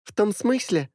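put-on high bright girl voice; high ringing voice. A narrator's reading. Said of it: "продолжила высоким звонким голосом Миля". (высокий звонкий голос)

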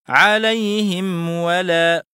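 عليهم ولا very slowly, m distinct before w; clear meem, unexaggerated. Shown as a demonstration. Teacher demonstrates for students, slow عليهم with clear, unexaggerated m. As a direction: draw out عليهم and release a clean m into ولا. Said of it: "فيتم إظهارها بالطبع من دون مبالغة".

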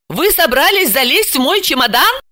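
high-pitched, affronted; indignant question. A narrator's reading. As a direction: demand it as if insulted. Возмущённая хозяйка допрашивает горничную. (возмущённо, высоким голосом)

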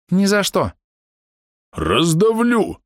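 high, slow, booming roar; thundering half-orc. Acted. Half-orc roars threats at the foe, slow and booming. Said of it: "прогремел полуорк".

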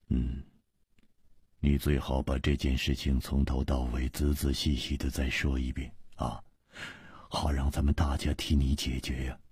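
low, soft character voice; companion urging a full account. Acted. Companion asks friend to retell it all, low-voiced urging. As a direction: keep it quiet and deep, urging him on. Story figure urges a retelling.

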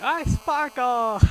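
high, slow, drawn-out mock vampire. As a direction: imitate a pop star playing a sparkly vampire. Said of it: "[name] do the part".

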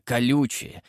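ordinary delivery, nothing marked

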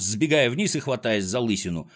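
plain reading voice

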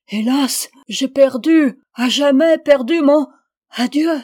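loud, high lament; despairing cry. A narrator's reading. Tall man leaving the narrator, loudly despairing.

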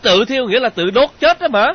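high, shouted clarification; loud explaining. Acted. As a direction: shout out what it means. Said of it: "Thế Sở lớn tiếng giải thích giùm cho Nhi".

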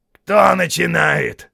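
loud, high, drawn-out challenge; quarrelsome troll. A narrator's reading. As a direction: throw the accusation back slowly.

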